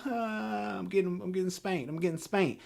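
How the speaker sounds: hammed-up kid's cry through a spanking; mock crying. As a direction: whine it out like a spanked kid. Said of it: "the normal cry".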